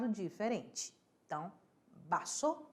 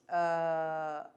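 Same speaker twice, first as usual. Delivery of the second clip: normal talking voice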